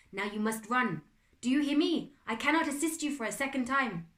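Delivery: put-on French accent. A narrator's reading. Butler ordering the boy to run, French accent. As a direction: press him to go, in a French accent. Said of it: "He recognised the French accent".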